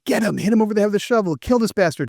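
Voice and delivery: fast, high egging-on; mock bloodthirsty urging. Viewer egging the hero on, fast and high. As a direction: cheer him on to kill, fast.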